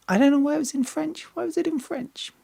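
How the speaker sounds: high, fast, baffled question; puzzled disbelief. Real, not acted. To viewers, puzzled by a French album title, high and baffled. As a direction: toss it up in mock bewilderment.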